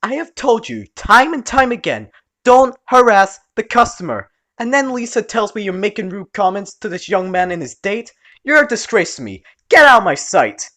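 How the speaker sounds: put-on loud, high angry old aunt; aunt scolds nephew in front of customers; furious scolding. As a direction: tear into him like an angry aunt.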